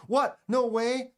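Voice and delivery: high, loud playful surprise; mock disbelief. Streamer reacting to a viewer claiming a win, mock astonishment. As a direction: exclaim as if amazed.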